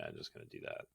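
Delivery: normal talking voice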